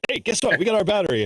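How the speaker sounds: high, triumphant retort; gleeful. Retold or imitated. Ham radio friend tells partner the spare battery saves them, high and gleeful. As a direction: pitch it way up, proud to save the day.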